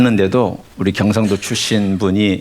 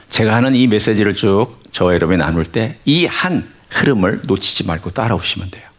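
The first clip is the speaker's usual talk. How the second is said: ordinary talk, nothing marked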